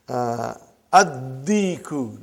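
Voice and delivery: 'addiku' said slowly with the d doubled; 'addiku', the lam swallowed into a doubled d; slow model word. Shown as a demonstration. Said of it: "not aldiku".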